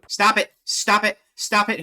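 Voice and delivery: loud, high panicked shouting; panicked yell. Real, not acted. Streamer yelling at a game jump scare. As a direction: yell at the intruder to stop.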